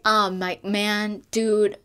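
ordinary talk, nothing marked

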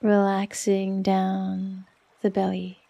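ordinary talk

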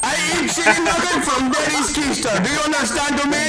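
loud mock rant. Gun store roleplay, loud rant.

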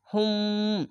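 হুম with the m held as a long nasal hum; ikhfa ghunna. Shown as a demonstration. Teacher showing hidden mim with ghunna, long humming হুম. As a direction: hold the closing ম of হুম through the nose. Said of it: "এখানে ইকফা মিম সাকিন গুন্না".

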